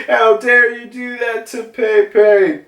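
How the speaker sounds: high, outraged female voice; furious Ute. Retold or imitated. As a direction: demand how he dared. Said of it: "she's like".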